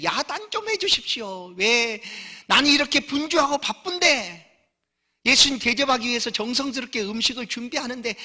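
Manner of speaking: high, loud, exasperated pleading to Jesus; Martha's pleading complaint. Acted. Martha begs Jesus to scold her idle sister, high and pleading. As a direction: whine it like a busy sister begging for help.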